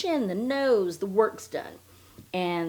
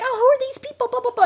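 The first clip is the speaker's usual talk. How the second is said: high, loud mock-frantic babble; retold panic; 8 dB louder, faster than usual. Frightened passenger questioning the driver, mock-hysterical. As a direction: imitate a panicked passenger, pitched way up.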